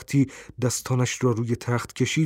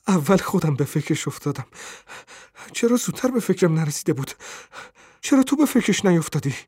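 rushed, higher-pitched, eager; excited; 6 semitones higher, faster than usual. A narrator's reading. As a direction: blurt it out in rising excitement. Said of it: "با هیجان گفت". (با هیجان و شتاب)

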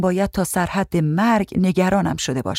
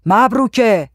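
loud, higher call for a servant; summoning yell, pitched up; impatient shout; 4 semitones higher, 5 dB louder, slower than usual. A narrator's reading. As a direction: shout the name, out of patience. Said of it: "فریاد زد".

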